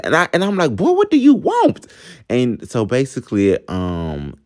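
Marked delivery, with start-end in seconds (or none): 0.8-1.9 very high, sassy mock demand; high-pitched acted-out annoyance; exasperated challenge. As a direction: squeal it at an unwelcome visitor.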